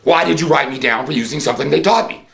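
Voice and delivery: normal talking voice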